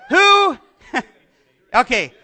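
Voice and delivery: flustered giggle at the crowd; laughing. Real, not acted. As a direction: laugh through the words, slowed down. Flustered by congregation's silence.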